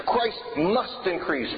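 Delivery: slow line with "must" stretched out; stress on must. Shown as a demonstration. Preacher showing his congregation the emphasis, stressing "must". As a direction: hold and lean on "must". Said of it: "Why do I put the emphasis there?".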